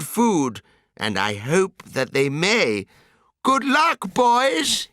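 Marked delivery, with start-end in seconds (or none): 3.4-4.9 high, sad send-off; Lorax crying farewell. A narrator's reading. Lorax sending the Bar-ba-loots away, high and sad. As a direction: wish them well as they leave, pitched high. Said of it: "he cried".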